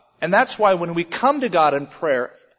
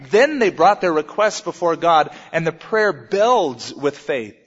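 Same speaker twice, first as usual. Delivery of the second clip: normal talking voice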